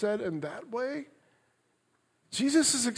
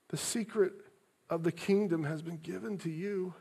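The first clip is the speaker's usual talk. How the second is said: sad and tender; 4 semitones lower, 10 dB quieter than usual. Shown as a demonstration. Preacher rereading Jesus' words to his flock, softly sad and loving. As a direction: speak it gently, as if grieving for them. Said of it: "Hear them with an inflection of sadness, of compassion, of love".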